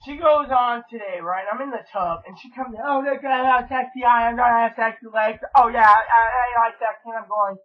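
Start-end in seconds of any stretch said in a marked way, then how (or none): 2.8-7.1 mock vain girlfriend. Imitating Chloe showing off.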